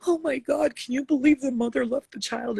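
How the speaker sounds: high, loud gushing over a sad movie; mock tearful moviegoer. Moviegoer crying at a film, high and loud mock dismay. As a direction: ham up a sobbing viewer's shock.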